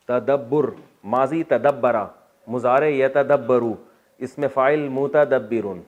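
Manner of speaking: unhurried teacher reciting each verb form; slow paradigm drill; slow recitation. Shown as a demonstration. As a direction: lay out each form for students to copy. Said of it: "reciting verb paradigm".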